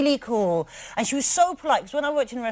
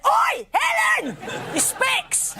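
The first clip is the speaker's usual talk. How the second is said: wolf voice; much slower than usual. Retold or imitated. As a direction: bellow it like a wolf. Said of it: "I just wolf voice went".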